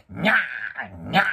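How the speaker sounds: slow, loud stretched meow; drawn-out imitation meow; cat's meow cries. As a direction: yowl like a cat waking you. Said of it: "って".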